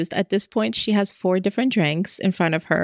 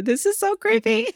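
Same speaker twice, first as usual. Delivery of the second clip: high squeal of creeped-out delight; mock horror; 13 semitones higher than usual. Real, not acted. Host reacting to the creepy crush story, high amused squeal. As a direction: shriek it like you're horrified but loving it.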